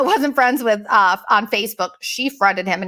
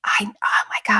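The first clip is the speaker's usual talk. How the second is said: hushed secretive whisper. Retold or imitated. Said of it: "whispered secret phone call".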